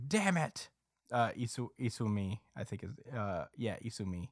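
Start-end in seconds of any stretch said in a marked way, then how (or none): 0.1-0.7 high-pitched mock outburst; frustrated kid. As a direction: imitate a kid cursing in frustration. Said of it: "the kid is just like".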